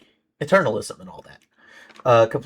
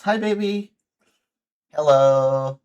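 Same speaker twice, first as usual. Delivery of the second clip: affectionate greeting; 5 semitones higher, 5 dB louder, slower than usual. Real, not acted. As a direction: coo a happy welcome.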